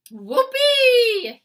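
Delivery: very loud, high gleeful whoop; whooping cry. A narrator's reading. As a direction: whoop with joy. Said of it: "he cried".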